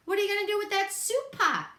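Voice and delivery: eager little rabbit asking; quick, high-pitched bunny voice; curious bunny. A narrator's reading. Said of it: "asked Buddy".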